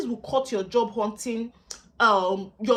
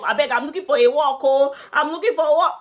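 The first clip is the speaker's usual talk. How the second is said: loud, raised-pitch plea for work; mock begging job-seeker; 5 semitones higher, 8 dB louder than usual. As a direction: beg loudly, hamming up the desperation. Mocks an unprofessional job-seeker's email.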